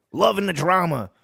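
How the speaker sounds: ordinary talk, nothing marked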